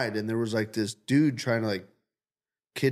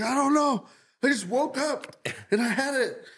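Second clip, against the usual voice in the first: high, puzzled voice imitating a friend; mock baffled friend; 12 semitones higher than usual. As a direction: play the clueless friend, pitched up.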